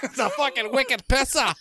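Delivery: hammed-up Boston accent. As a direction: play a Bostonian, wicked pisser. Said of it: "Boston up in here".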